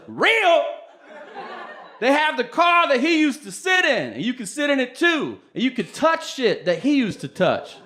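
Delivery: high, loud, gushing fan voice; excited Bruce Bruce. Retold or imitated. Bruce Bruce gushing to comic about museum, high excited shout.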